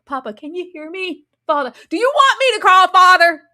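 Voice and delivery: high, loud whiny prince voice, building; sing-song taunt that swells to a loud call; mocking bratty prince. Mocking Egg calling father.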